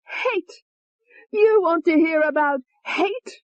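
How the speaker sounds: high, venomous female alter; high hateful young woman; vicious Tony. Acted. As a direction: sneer the threat up high. Tony's voice.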